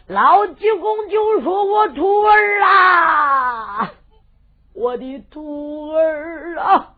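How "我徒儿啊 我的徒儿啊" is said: very slow, drawn-out weeping cry; grieving cry. Acted. Old master mourning his eight slain disciples, weeping cry. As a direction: wail for your lost disciples.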